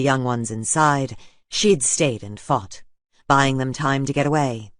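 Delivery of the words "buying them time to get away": usual speaking voice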